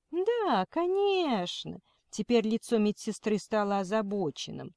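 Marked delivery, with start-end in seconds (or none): 0.1-1.8 high, slow sugary voice; kindergarten teacher voice. Acted. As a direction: coo it falsely sweet. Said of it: "она засюсюкала".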